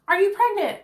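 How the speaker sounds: high, indignant repeat of the question; high-pitched offended disbelief; incredulous echo. Retold or imitated. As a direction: throw his words back in shock. Echoing his question in disbelief.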